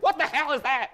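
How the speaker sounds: high, loud panicked cry; mock horrified. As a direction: shriek it like you saw a monster. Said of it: "It's more like".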